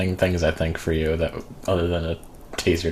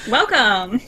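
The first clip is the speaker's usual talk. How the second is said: squeaky; 4 dB louder than usual. Pretend. Squeaky joke reply.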